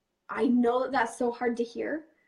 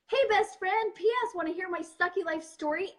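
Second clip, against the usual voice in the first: higher, sing-song fake cheer; mock chirpy greeting; 7 semitones higher than usual. Confessing a sad story to a best friend, mock chirpy. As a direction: play it like a breezy hello hiding embarrassment.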